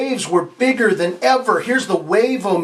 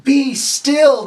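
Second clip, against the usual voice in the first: higher, firm command to the storm; Jesus calming the storm with a firm command; 5 semitones higher, 3 dB louder, much slower than usual. Acted. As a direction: order the wind to stop, with authority. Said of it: "He said".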